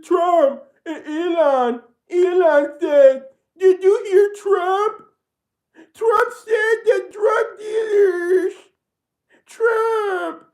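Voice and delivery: hammed-up wailing parody, high and slow; mock her like a whining, sobbing caricature; exaggerated crying-voice impression of the congresswoman. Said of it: "This is what she said".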